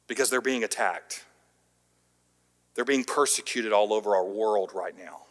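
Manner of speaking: ordinary talk, nothing marked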